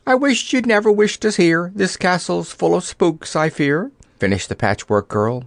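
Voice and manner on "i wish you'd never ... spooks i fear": high-pitched, fretful complaint; nervous reproach. A narrator's reading. Scraps reproaches the Wizard for wishing them here, fretfully. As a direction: scold in a high, worried voice. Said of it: "shaking her finger reproachfully".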